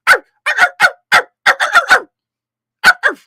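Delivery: loud high rolled arr sounds; rolled R growl. Pretend. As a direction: roll the R's loud like a pirate. Rolling R's shout to Snoopy.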